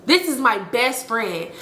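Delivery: "best friend" stressed and drawn out. Retold or imitated. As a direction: slowly land on "best friend" with emphasis. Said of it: "I'm putting be-, emphasis on best friend".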